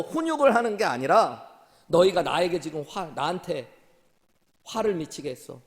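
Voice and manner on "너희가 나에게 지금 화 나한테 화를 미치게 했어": normal talking voice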